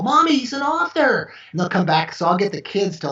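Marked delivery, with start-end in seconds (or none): 0.0-1.3 put-on high, excited child calling mom; a small kid tugging at mom, high. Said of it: "And they'll go".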